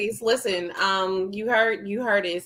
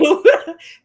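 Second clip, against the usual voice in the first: loud laugh; 6 semitones higher, 10 dB louder than usual. Real, not acted. Reacting to her own advice.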